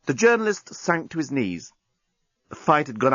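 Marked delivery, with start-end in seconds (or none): none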